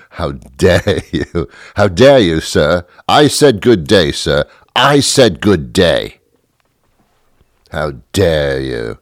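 mock-affronted British accent with drawn-out emphasis; hammed-up British indignation; indignant British accent. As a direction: dismiss them in a huffy put-on British accent. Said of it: "we put on a British accent".

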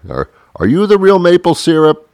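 curious questioner. Pretend. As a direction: ask the syrup like a wondering child.

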